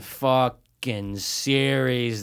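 drawn out very slowly. Acted. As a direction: stretch every word out slowly. Joking about endless series.

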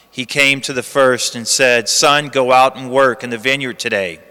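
low, hushed Gospel reading; cathedral echo. Acted. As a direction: read the Gospel solemnly, like in church. Liturgical Gospel reading at the lectern.

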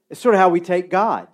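ordinary delivery

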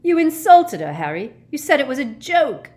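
high, loud accusation; outraged lovesick shout. A narrator's reading. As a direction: yell it in outrage. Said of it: "shouted Ron".

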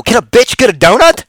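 high, loud demanding punchline. Real, not acted. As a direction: deliver the catchphrase hard. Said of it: "Boom, there it was".

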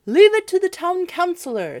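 high, loud chorus of townsfolk; citizens' high voices. A narrator's reading. Citizens reassuring one another, high and loud. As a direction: pipe up as the townsfolk. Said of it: "said the citizens one to another".

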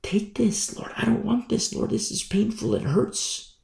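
soft, high-pitched plea to God; acted anguished begging; pleading prayer. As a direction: plead softly, high in the voice, as if in pain. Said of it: "have you ever prayed to the Lord, like".

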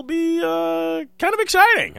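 high squeaky excitement; excited squeal. Real, not acted. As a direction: squeal it with glee. Squeaky excited pitch jump.

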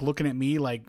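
ordinary talk

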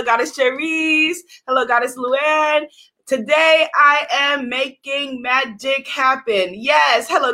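ordinary delivery

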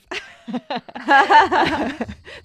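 big high real laugh, falling away; loud laugh. Real, not acted. Host cracking up during the podcast sign-off, loud and high. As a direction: burst out laughing, then let it fade.

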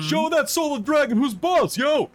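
high, loud heckling yell; rowdy crowd shout. Acted. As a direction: cheer him on from the stands. GM voices cheering audience member.

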